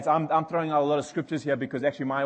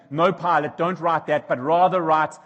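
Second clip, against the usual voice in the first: raised, insistent objection; retold protest; 5 semitones higher, 8 dB louder than usual. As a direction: urge Pilate, louder and pushing.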